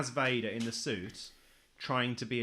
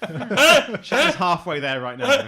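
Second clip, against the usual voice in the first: laughing; 7 semitones higher, 13 dB louder, much slower than usual. Real, not acted. Joking about Shatner's looks.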